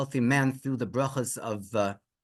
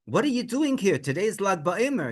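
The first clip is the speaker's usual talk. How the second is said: higher-pitched, insistent questioning; urgent challenge; 6 semitones higher than usual. Retold or imitated. Friend confronting the father on Lag BaOmer, urgent. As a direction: press him as if he should know.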